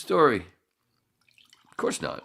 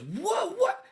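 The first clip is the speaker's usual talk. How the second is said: high startled what. Retold or imitated. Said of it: "everybody goes".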